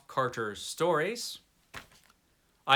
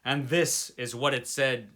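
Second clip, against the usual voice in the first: ordinary delivery, nothing marked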